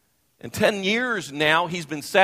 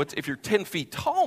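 ordinary delivery

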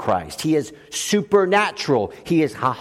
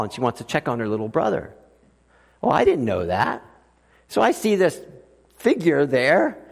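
ordinary delivery

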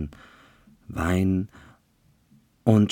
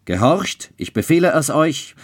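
high, loud, fast barked order; furious command; 9 semitones higher, 5 dB louder than usual. A narrator's reading. Childeret orders the priest to obey, furious. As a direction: crush all resistance.

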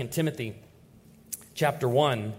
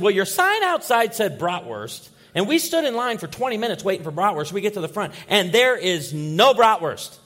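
irritated complaint; 5 semitones higher, 7 dB louder than usual. Retold or imitated. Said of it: "we're pretty ticked off".

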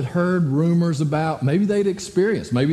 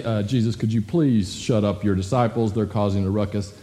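low-voiced mock official; politely lodge a complaint in a put-on low voice; lightly imitating stuffy religious leaders, pitched down; complaining Pharisees; 8 semitones lower than usual. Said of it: "it's so funny because they say".

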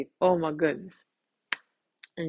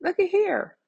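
much higher, thrilled little squeal at the start; gushing to herself about loyal listeners, slowing at the end; delighted, high-pitched retold reaction; delighted gasp; 7 semitones higher, slower than usual. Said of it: "I'm like".

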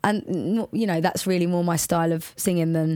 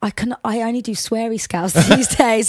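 ordinary delivery

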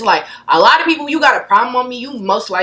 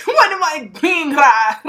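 laughing at own tongue twister; cracking up at her own line; 8 semitones higher, slower than usual. Real, not acted. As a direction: giggle through the question. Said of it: "What the fuck".

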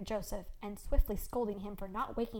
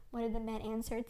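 plain reading voice

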